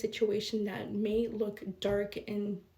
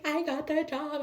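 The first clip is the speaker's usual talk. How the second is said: excited announcement; 6 semitones higher than usual. Retold or imitated. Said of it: "And I'm like".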